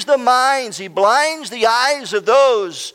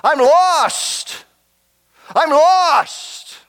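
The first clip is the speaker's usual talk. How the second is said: higher-pitched cry of a man lost; dying king's last words; 7 semitones higher, much slower than usual. Retold or imitated. Preacher voices a stabbed king's last words, anguished cry. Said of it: "his last words there, lying in his own blood, were this".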